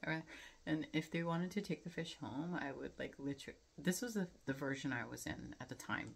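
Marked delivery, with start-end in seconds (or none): none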